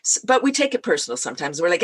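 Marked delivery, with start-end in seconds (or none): none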